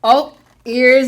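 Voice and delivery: ordinary delivery, nothing marked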